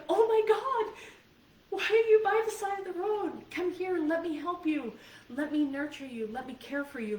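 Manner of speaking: caring alarm. Pretend. Comforting a lost child by the road, alarmed then tender. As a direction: rush to comfort a frightened child. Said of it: "You would be like".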